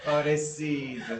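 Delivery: slow, laughing pity. Real, not acted. Co-host laughing in mock pity for Vivaldi. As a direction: laugh through the words.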